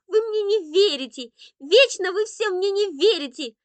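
high, loud wailing accusation; inconsolable child. A narrator's reading. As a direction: wail at the family like a hurt little boy. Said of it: "Андрейка никак не хотел успокаиваться".

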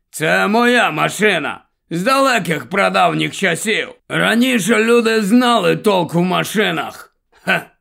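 lightly voiced old man, puffed up and proud; a hint of a self-important grandfather boasting; pompous old man. A narrator's reading. Old grandfather boasting about his car, lightly pompous. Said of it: "Голос у нього надутий".

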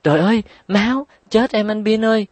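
high, fast, frightened cry; panicked shriek. A narrator's reading. Kiki cries out to Bin on seeing her bleeding toe, high and frightened. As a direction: shriek at the sight of blood. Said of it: "hoảng sợ hét toáng lên".

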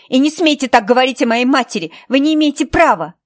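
loud, high angry protest; furious defence. Acted. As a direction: shout her down for the mother. Katie defends her mother. (Кэти яростно защищает мать)

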